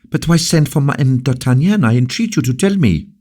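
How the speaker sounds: high, fast, urgent pleading; anxious plea. Acted. La Valliere pleads with the king, high and urgent. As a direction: beg the king to explain, quickly.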